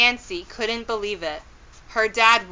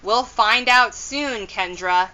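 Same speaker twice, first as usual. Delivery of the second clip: usual speaking voice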